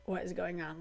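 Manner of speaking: exasperated aside. Retold or imitated. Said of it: "made me be like".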